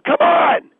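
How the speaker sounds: very high, loud yell; yelling come on. Acted. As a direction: scream it in frustration. Boss yelling at host.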